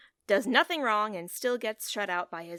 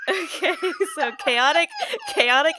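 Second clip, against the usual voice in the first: laughing; 8 semitones higher, 7 dB louder than usual. Real, not acted.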